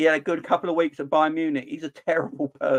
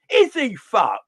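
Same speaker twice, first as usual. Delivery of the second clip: incredulous; 7 semitones higher, 5 dB louder, slower than usual. Real, not acted. Incredulous outburst.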